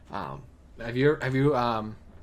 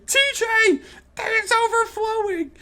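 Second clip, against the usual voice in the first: high panicked shout; panicked yell; 4 dB louder, slower than usual. Retold or imitated. Panicked kid alerting friend.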